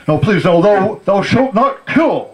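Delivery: mock-solemn sermon from an old father, laughing; pompous old father. Father preaching to his daughter, mock solemn and high. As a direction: lecture in high, preachy thou-shalt tones. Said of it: "Like this".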